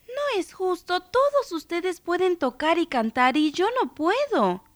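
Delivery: put-on high-pitched childish complaint; complaining girl. A narrator's reading. Girl protests to her musical family, high complaining voice. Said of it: "se quejó en voz alta".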